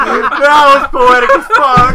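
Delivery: slow, laughing delight. Real, not acted. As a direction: say it through big laughter. Reacting to funny text.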